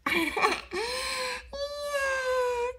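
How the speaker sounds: long, high, real giggling fit; a high, long burst of giggles. Real, not acted. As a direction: laugh hard at the funny voice. Child laughs at the silly king.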